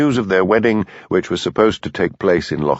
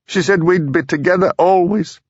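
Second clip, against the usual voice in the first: tearful; 10 semitones higher than usual. Acted. Frida grieving after wailing.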